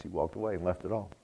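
dropped low and soft; hushed. Acted. Preacher telling the congregation, low hush. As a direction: let it sink in quietly. Hushed dramatic point in sermon.

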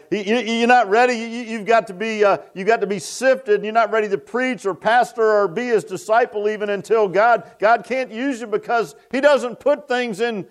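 high-pitched, driving sermon delivery; fervent preaching. Real, not acted. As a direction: preach it with rising fervor.

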